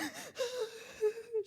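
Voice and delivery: high, breathless sobbing gasp; imitating a bawling gasp for air; mock crying gasp. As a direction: gulp for air like you're bawling. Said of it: "And I'm just".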